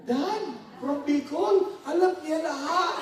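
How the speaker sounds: frantic hammed-up disbelief; panicked. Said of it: "lalo siya talagang napanik". (kunwaring takot, pataas nang pataas ang boses)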